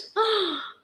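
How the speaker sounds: a quick high gasp of delight; mock excited gasp. Gasping at the dream of a government job. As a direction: gasp as if thrilled by the idea.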